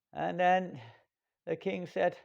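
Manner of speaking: normal talking voice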